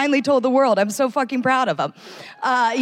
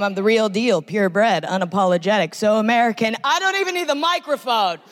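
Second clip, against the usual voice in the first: ordinary talk, nothing marked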